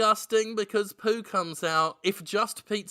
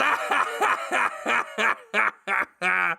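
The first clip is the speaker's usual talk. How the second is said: evil laugh. Acted. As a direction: cackle like a monster. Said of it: "Willem Dafoe laughs".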